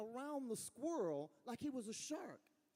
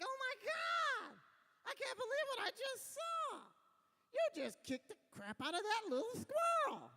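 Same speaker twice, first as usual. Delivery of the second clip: high, loud shrieking woman; screaming lady; 4 dB louder than usual. Retold or imitated. Lady screaming at the comic over the kicked squirrel, high shrieking. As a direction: shriek it like an outraged lady at the park. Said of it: "she started screaming and yelling at me".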